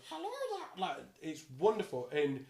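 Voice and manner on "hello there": very high, soft little creature voice; tiny gremlin falsetto; small gremlin creature. Acted. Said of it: "the small gremlin creature".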